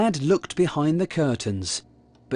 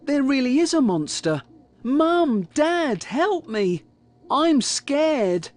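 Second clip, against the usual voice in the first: put-on high, frightened child's cry; scared yelling; 10 semitones higher than usual. A narrator's reading. Kipper calling Mum and Dad about a real monster, scared. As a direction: call out for rescue, pitched high. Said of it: "yelled Kipper".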